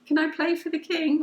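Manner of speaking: put-on high, small innocent child voice; little drummer boy. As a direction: plead sweetly like a shy little child. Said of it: "little drummer boy energy".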